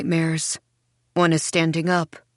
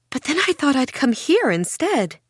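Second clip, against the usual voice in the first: high, lilting self-satisfied customer; higher-pitched, smug swoops; pleased with herself; 8 semitones higher than usual. A narrator's reading. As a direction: sound pleased with your own good deed. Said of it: "so pleased with herself".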